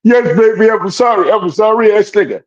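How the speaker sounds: high-pitched mock pleading; grovelling boyfriend. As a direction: beg in a hammed-up high voice. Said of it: "you know what he says back?".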